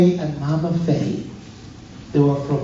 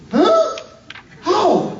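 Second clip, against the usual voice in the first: very high-pitched questioning yelp; squeaky-high acted surprise; mock bafflement. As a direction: imitate a listener utterly puzzled. Acts baffled listeners' reaction.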